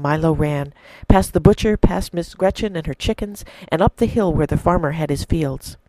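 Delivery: a preacher narrating a parable to the church, in an expressive storytelling voice; story narration. A narrator's reading. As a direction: tell it like a fireside story to the congregation. Sermon told as a story.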